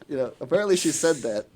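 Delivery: ordinary delivery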